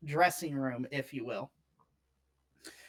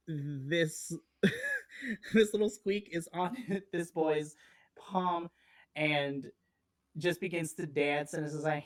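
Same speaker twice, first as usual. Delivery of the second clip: ordinary talk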